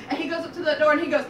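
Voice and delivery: usual speaking voice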